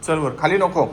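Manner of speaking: loud, high call to a dog. Real, not acted. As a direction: call the dog up the stairs firmly. Calling dog upstairs. (कुत्र्याला मोठ्याने हाक)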